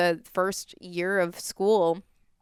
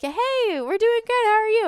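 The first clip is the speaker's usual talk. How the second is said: very high, louder mock holler; retold yell back; 5 dB louder than usual. Boldest friend answering the man at the window, mock yell back. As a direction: imitate calling back brightly across a street. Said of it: "so I yell back".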